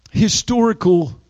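ordinary talk, nothing marked

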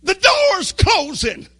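high, loud panicked scream; high-pitched shout of alarm. Acted. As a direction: scream it out as a warning to everyone. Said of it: "somebody screamed out and said".

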